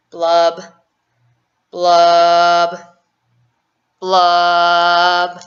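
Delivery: long, loud, hollow fish blubs, building; loud drawn-out blubs. Acted.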